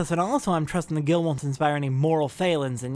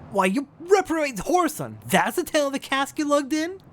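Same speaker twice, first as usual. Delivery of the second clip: angry; 3 semitones higher than usual. Acted. Captain scolds crewman.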